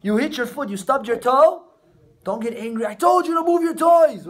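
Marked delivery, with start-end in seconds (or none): 2.9-4.3 high, louder exasperated scolding; scolding parent. Acted. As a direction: snap at the kid about the toys.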